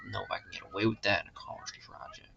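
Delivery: quick whisper to himself; under-the-breath doubt; whispered aside. Real, not acted. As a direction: mutter it in a fast whisper.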